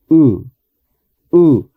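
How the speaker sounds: "U" with lips barely parted, short; short English U. Shown as a demonstration. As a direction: say "U" relaxed, lips apart. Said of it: "Y decimos".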